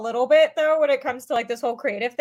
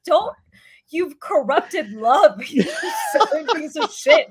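higher, exasperated rant breaking into laughter; mock fury; 5 semitones higher, 4 dB louder, slower than usual. Woman berating her Southern fiancé, laughing mock fury. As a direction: scold with comic outrage, drawing it out. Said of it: "It makes me so mad".

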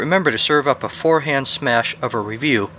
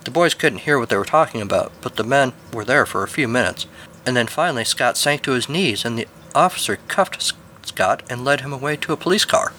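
ordinary delivery

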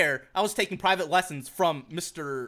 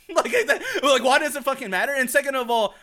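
higher, louder, laughing through the question; laughing exasperation; 5 semitones higher, 5 dB louder than usual. Real, not acted. Ranting to friends about the band teacher, laughing in exasperation. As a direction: laugh in disbelief at the pettiness.

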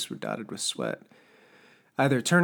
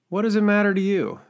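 higher, louder indignant challenge; angry retort; 10 semitones higher, 9 dB louder than usual. Acted. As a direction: throw the question back hard. Smoker snaps back at Turner.

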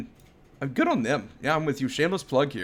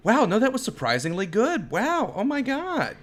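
surprised praise; 9 semitones higher than usual. Retold or imitated. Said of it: "they're like".

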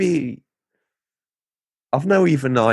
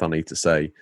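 ordinary talk

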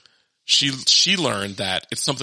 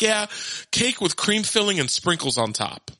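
higher, innocent child imitation; earnest young daughter; 8 semitones higher than usual. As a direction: explain it like a matter-of-fact kid. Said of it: "She's like".

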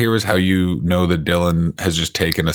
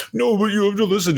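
much higher whiny mock Dylan protest; 12 semitones higher than usual. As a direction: squeak it like a stubborn friend pleading. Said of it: "No, I don't talk like that".

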